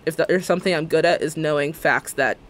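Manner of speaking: usual speaking voice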